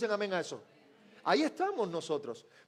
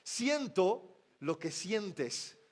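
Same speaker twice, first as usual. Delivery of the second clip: slow, tender, lower voice; tender voice of Jesus; 4 semitones lower, much slower than usual. Acted. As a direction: comfort the grieving as Jesus. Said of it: "está diciendo con esas lágrimas". (Jesús consolando, voz tierna y lenta)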